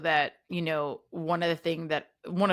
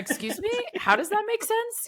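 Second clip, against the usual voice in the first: incredulous. Retold or imitated. Impersonating Marty's incredulous question.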